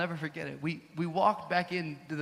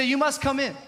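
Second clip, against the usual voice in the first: higher, louder insisting invitation; insistent host; 8 semitones higher, 8 dB louder than usual. Retold or imitated. Hotel owner inviting the couple inside, insistent. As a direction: urge him in like a gracious host.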